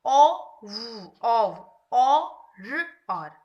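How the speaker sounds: slow, loud sound-by-sound blending; teacher blending two-letter words for a child; phonics blending. Shown as a demonstration. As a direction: say each sound, then join them. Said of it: "ओ की blending".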